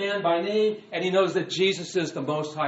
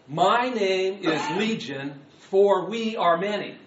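slow, loud proclamation; demon's declaration; 3 semitones higher, 6 dB louder, slower than usual. Acted. Demon answering Jesus, slow and forceful. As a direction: announce the name deliberately and forcefully.

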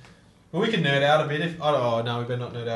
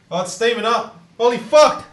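loud, high-pitched startled outburst; real shock, voice jumping up; alarmed excitement; 8 semitones higher, 9 dB louder than usual. Real, not acted. Friend startled by smoke from a can, alarmed excitement.

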